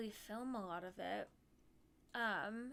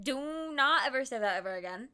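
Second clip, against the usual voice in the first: mock stern; 7 dB louder than usual. Retold horrified reply.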